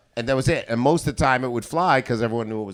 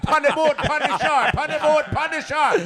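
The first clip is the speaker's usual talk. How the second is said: much higher shouted Jamaican patois; shouting Jamaican patois character; 13 semitones higher, slower than usual. Acted. Comic acts his character yelling at heckler, shouted Jamaican patois. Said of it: "stayed in character".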